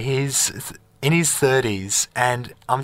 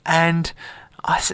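ordinary delivery

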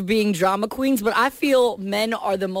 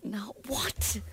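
breathy whispered aside; 14 dB quieter than usual. Real, not acted. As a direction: whisper it under your breath. Whispered prompt to audience.

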